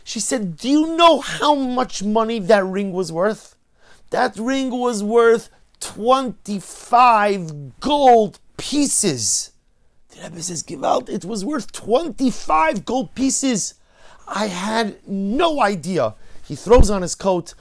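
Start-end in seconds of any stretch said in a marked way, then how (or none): 0.6-9.5 high, loud and slow, hammering the price; angry yelling. Acted. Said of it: "She starts yelling at her husband". 10.6-16.2 high, slow cry of dismay; shocked gasp. Acted. Rebbe shocked at the price.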